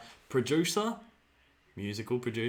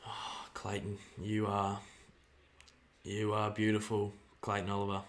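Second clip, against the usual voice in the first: tender praise; 5 semitones lower, 6 dB quieter than usual. Real, not acted. Doting praise of a player.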